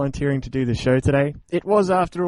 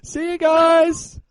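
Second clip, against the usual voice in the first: farewell shout; 4 dB louder, much slower than usual. Real, not acted.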